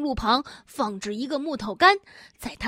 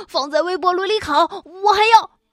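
angry; 6 semitones higher, 5 dB louder than usual. A narrator's reading. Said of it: "我的嗓子都哑了".

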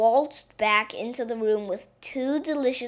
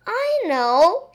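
high, loud burst of discovery; sudden excited cry; 9 semitones higher, 8 dB louder than usual. A narrator's reading. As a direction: cry it out as a sudden bright idea. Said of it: "she suddenly cried".

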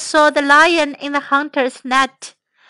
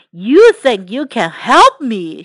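lower, incredulous lion voice; scornful lion; 4 semitones lower, 4 dB louder than usual. Acted. Lion questions the tiny mouse, scornful. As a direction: question it with big swoops of disbelief.

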